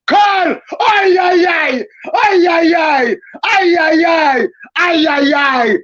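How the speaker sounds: high, excited ay-ay-ay cries; rising "ay, ay, ay" yells of joy; ecstatic cries. Real, not acted. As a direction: cheer it out like a fan on his feet. Wild cheering at a chance.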